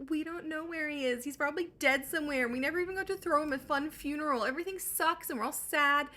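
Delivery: high-pitched, put-on whine of a weepy father; mock crying. Weepy father answers the stranger, mock crying. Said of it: "Laertes starts crying and is like".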